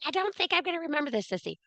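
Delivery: high, fast, mock-whiny protest; a fast, high mock complaint from the viewers. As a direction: squeak it out like an overwhelmed student. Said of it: "Everybody's like".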